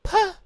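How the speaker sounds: high, loud squeaked puff; imitated nervous squeak; blurted puh. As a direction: burst out one strangled high syllable. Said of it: "She just says, "Puh".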